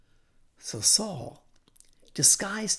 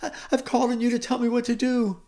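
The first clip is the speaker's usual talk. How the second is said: fast, loud, high pleading; great distress; 6 semitones higher, 7 dB louder, faster than usual. Acted. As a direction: pour it out as a desperate king. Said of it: "I'm in great distress".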